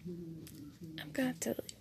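normal talking voice

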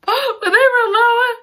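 high, breathless gasp before the line; gasp of excitement. Real, not acted. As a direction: gasp in delight as you say it. Gasping at the dramatic reveal.

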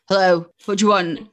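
a hint of a tired, short mum answering the phone; mum's after-work voice. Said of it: "this voice that she uses after work".

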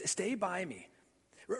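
usual speaking voice

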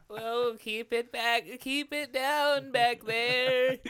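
put-on high, slow old man voice; creaky old man scolding; Hans Moleman voice. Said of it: "Hans Moleman is the bus driver".

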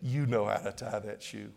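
a hint of a patient dad; a father's voice. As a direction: drop into a knowing fatherly reminder. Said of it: "you're gonna hear a voice saying".

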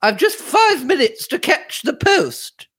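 very high-pitched calling voice. A narrator's reading. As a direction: call it through high to the next room. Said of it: "she called".

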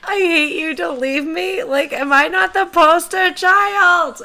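very high, loud, hammed-up crying voice; mock wailing. Podcaster begging her ex not to leave, mock wail. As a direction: wail it like a melodramatic abandoned child.

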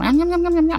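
high, quick villager gibberish; Animal Crossing babble. Retold or imitated. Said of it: "みたいな喋り方するのよ".

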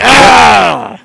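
mock wounded cry. Co-host reacting to being ignored, mock wounded cry. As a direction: cry out as if personally hurt.

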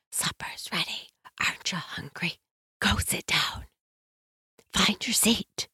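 soft unvoiced whisper. Shown as a demonstration. Parent whispering supper call to noisy kids. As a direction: whisper it to hush the room. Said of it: "I might start whispering".

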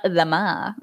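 "Lama" as luh-MAH, stress last; "Lama" in a playful French-style pronunciation; mock-French pronunciation. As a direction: pretend "Lama" is French, lifting the final MAH. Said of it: "I'm gonna pronounce it now".

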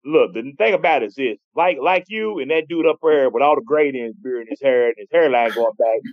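usual speaking voice

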